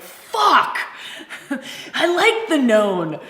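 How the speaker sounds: loud, high mock outcry with a laugh; burst of discomfort. Facilitator voices the group's unease about the unknown, mock uncomfortable outburst. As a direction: blurt it out loudly, half-laughing at the discomfort. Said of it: "quick little bubble up of uncomfort".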